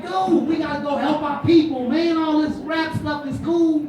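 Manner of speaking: rally him to help people; fired-up plea. Retold or imitated. Pleading with the friend.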